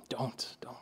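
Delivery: very soft, almost whispered urging; hushed plea. Real, not acted. Preacher warning against betting on yourself, hushed plea. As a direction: drop right down and implore them.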